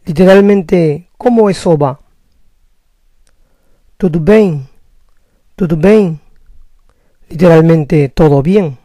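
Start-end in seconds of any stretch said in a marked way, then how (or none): 4.0-6.2 Tudo bem? with bem nasal "being"; Brazilian tudo bem. Shown as a demonstration. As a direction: ask it the Brazilian way. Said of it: "¿Cómo estás?".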